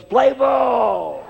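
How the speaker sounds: high shouted call from the stands; drunk's yell. Retold or imitated. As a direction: bellow it like a drunk fan. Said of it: "he yells".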